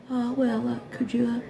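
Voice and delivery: high, soft, timid request; small high voice, tiptoeing into the question; mock hesitant asking. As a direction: imitate someone too shy to ask outright. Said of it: "I don't go".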